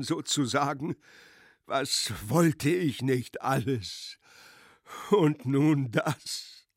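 high, slow, loud anguished wail; devastated lament. Acted. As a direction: lament your ruin, broken. Ruined general laments. (verzweifelte, langsame Klage)

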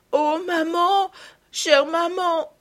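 put-on a child flinging herself at her mother; crying out. Marguerite cries out as she throws herself into her mother's arms, high and slow. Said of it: "s'écria Marguerite".

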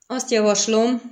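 firm, cold pronouncement; icy voice. A narrator's reading. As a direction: state it with icy authority.

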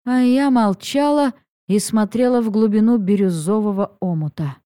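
ordinary delivery, nothing marked

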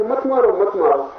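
high-pitched mock cry of pleading; imitated crying out. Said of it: "चिल्लाए चले जा रहे हैं". (चिल्लाकर रोकने की नकल)

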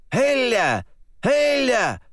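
high, loud cackling cry; cawing old shaman. Acted. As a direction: caw it like an old bird. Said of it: "И заквохтал, закаркал".

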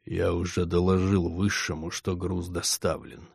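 cold hissing layered whisper. A narrator's reading. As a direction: speak from beyond the grave. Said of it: "заговорил тихим загробным голосом".

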